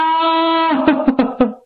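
a drawn-out acted sob, falling away; mock wailing sob. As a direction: let out a hammed-up mourning wail. Servant wails kneeling.

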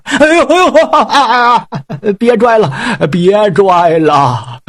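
high yelping howls of pain; pained begging cries. Acted. Pig demon howls in pain begging Yang Jian to stop. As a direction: cry out begging him to stop pulling. Pig's heart yanked.

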